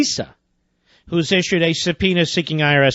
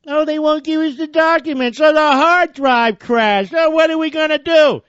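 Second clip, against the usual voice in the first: mock whining; 9 semitones higher than usual. Host mimics helpless committee chairmen, high mock whine. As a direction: whine it like a hammed-up crybaby. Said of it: "whine like a bunch of stuck pigs".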